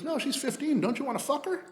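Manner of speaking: very high mock woman's voice; high-pitched, insistent imitation of the woman; imitated woman. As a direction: push the pitch way up to play her. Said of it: "she goes".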